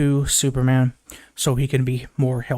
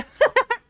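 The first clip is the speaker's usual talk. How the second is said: high-pitched mock cackle; imitated laughing fit. Supergirl laughing as her dog jumps on her, imitated laugh. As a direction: imitate helpless giggling, pitched way up. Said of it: "laughing her ass off".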